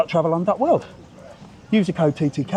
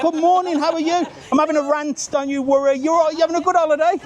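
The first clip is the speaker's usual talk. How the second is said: much higher, sing-song chirpy greetings; chirpy chatter; 12 semitones higher than usual. Retold or imitated. Mocking chatty women greeting passers-by, high chirpy voice. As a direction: gush like a chatty holiday woman. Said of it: "Women talking. Can't stop chat".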